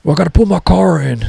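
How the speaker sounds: put-on a fussy old guy barging into the garage; crazy old guy. Old roommate interrupting to park his car, fussy put-on voice. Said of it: "I live with this crazy old guy".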